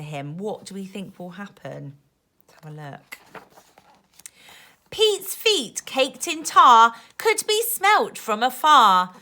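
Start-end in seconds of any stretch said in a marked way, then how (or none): 4.9-9.1 put-on lively rhyming read-aloud for kids; reading to children. A narrator's reading. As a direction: bounce the rhymes like a bedtime book. Reading rhyming picture book aloud.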